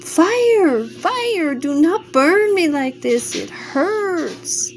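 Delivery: high, pleading cry of pain. A narrator's reading. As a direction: beg the fire to stop, high-pitched. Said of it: "he cried out".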